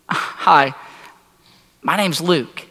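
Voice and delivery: halting, awkward self-introduction with long pauses. Acted. Luke introducing himself to the mother he was drawn to, awkward and halting. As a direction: introduce yourself awkwardly, ending on an unsure question. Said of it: "It was a bit awkward".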